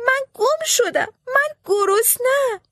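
put-on boy faking tears for enemy soldiers; fake crying. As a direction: pretend to be a lost hungry child. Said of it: "ناله کرد و داد زد".

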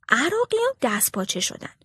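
usual speaking voice

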